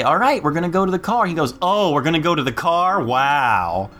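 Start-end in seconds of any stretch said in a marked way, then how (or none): 0.0-1.2 quick, higher-pitched mom voice; mom announcing. Retold or imitated. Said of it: "Like, she'll say". 1.6-3.9 mock-amazed sarcastic child. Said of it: "he's learned sarcasm at four".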